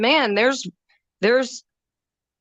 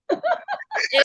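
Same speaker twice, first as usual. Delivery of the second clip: short laugh. Real, not acted. Laughs after complimenting husband.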